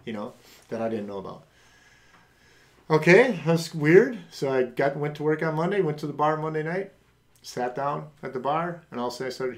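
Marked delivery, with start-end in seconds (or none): 2.9-4.2 surprised. Retold or imitated. Reacting to strangers hugging him.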